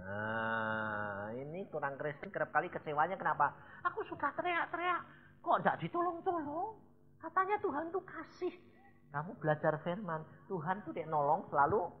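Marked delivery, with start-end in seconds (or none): 3.8-8.5 high, plaintive, mock-complaining believer; whiny complaint. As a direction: imitate a let-down believer whining to God. Mimics a disappointed believer complaining. (suara jemaat merengek kecewa)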